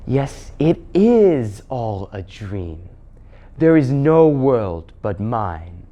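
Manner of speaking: very slow, hypnotic queen; witch queen. Acted. Witch queen enchanting the children, slow and spellbinding. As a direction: lull them, drawing each word out.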